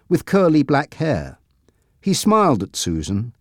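plain reading voice

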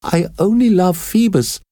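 put-on high, defiant girl's cry. A narrator's reading. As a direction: throw it back at him. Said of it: "cried Esmeralda".

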